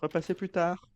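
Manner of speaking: high, stiff recorded-message voice; answering machine voice. Pretend. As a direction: play a voicemail greeting.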